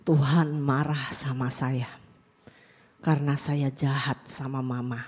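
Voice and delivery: put-on low, slow, halting child's admission; subdued and sorrowful, with long pauses; retold tearful confession. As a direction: quietly imitate a child confessing through tears. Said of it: "Dia ngomong nangis".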